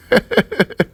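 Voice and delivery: high chuckle; amused, high-pitched laugh; real laugh. Real, not acted. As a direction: laugh at a line just read. Amused by the article's quote.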